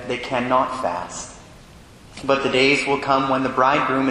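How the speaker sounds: formal Gospel proclamation; cathedral echo. Acted. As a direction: reverent liturgical reading. Priest proclaims the Gospel at Mass.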